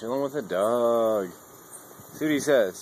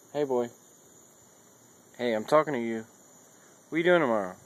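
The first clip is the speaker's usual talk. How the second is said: ordinary talk, nothing marked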